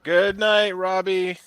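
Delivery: loud, high farewell call; calling out goodbye. Real, not acted. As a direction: sing out a cheery goodbye. Calling goodbye to departing friend.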